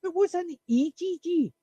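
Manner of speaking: spring the trick question on them; coworker's punchline question. Retold or imitated. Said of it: "she goes".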